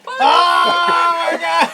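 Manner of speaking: slow, high, loud oh my God; shocked shout. Acted. Game master reacting to the poop, loud shock. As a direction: howl it out in mock horror.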